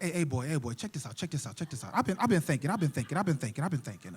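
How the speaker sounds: low, soft, repetitive pimp patter; seventies pimp. Acted. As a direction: mumble it like an old-school hustler. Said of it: "seventies pimp dialogue".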